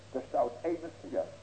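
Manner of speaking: ordinary talk, nothing marked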